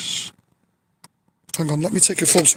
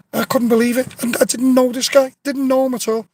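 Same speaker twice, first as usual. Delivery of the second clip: amazed; 8 semitones higher than usual. Real, not acted. Amazed at stranger's kindness.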